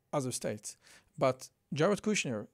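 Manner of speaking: ordinary delivery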